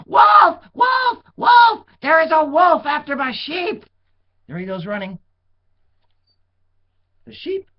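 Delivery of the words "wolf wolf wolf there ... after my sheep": very high, loud panicked shouts; yelling wolf. A narrator's reading. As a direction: scream it to the whole town.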